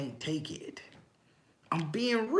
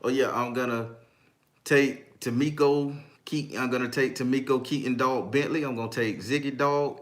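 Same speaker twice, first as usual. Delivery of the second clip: ordinary delivery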